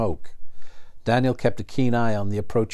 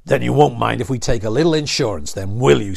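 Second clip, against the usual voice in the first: a louder, menacing snarl; snarled threat; 7 dB louder than usual. A narrator's reading. Storm Rider threatens Daniel, snarling and louder. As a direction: threaten them with a sneer. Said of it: "snarled the Storm Rider".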